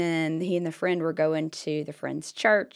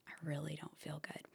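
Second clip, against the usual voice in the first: soft unvoiced inner whisper; whispered thought; 4 semitones lower, 20 dB quieter, faster than usual. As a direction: whisper it to yourself. Inner thought while salesman talks.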